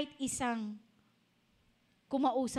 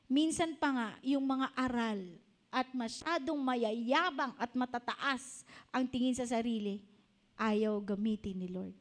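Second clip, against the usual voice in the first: ordinary talk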